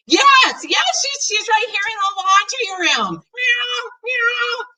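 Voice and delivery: thrilled reply. Acted. Girl announcing the found cat, excited high voice. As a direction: answer breathless with joy.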